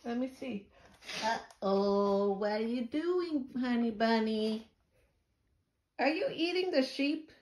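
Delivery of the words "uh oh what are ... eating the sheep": higher and higher mock no, no. Real, not acted. Mother sees baby chewing toy sheep, rising playful no.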